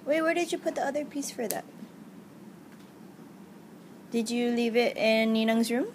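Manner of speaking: ordinary talk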